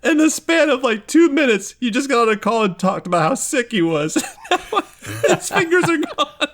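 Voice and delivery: high, squeaky laugh-talk; words squeezed out through laughter; laughing hard. Real, not acted. GM cracking up at Rory losing his fingers. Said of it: "laughing reaction".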